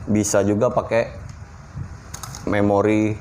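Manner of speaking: normal talking voice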